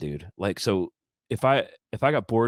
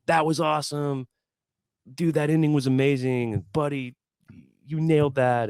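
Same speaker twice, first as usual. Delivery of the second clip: higher, upbeat praise; lightly voiced hype man; 6 semitones higher than usual. [name] praising bandmates after the big ending, upbeat. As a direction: cheer the band on like a ringleader.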